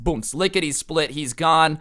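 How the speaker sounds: ordinary delivery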